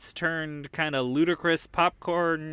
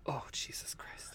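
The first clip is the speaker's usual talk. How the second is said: whisper; 3 semitones lower, 18 dB quieter than usual. Real, not acted. Groan at the joke.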